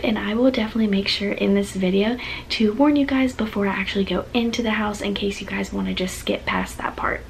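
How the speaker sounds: soft whispered vlog talk; whispering. Real, not acted. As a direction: keep it hushed, ASMR-like. Whispered vlog intro throughout.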